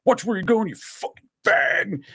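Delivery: retold indignant outburst, pitch jumping up; angry confrontation. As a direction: act out the irate guy who got bumped. Acting out angry bump in aisle.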